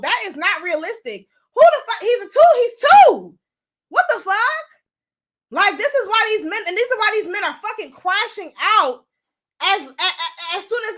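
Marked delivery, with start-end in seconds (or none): 1.5-4.7 high, loud incredulous shout; outraged outburst. Real, not acted. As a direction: blast the disbelief. Outraged at raising a two-year-old.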